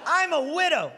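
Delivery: high-pitched mimicking cry; mock heckler yell. Repeating the woman's outburst in the club, high mock yell. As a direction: throw it out high like an indignant heckler. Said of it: "She yelled this out".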